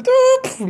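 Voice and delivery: sharp high tup of the door slamming; sound effect. Retold or imitated. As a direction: snap out a high door-clap noise. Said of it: "Los efectos de sonido bien mamalones". (efecto de sonido agudo del golpe)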